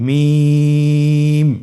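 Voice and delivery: মিম with the vowel held very long and thin; slow held recitation of মিম; long drawn vowel. Shown as a demonstration. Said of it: "আবার বলুন".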